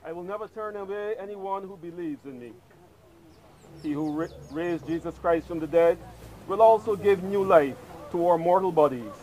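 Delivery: usual speaking voice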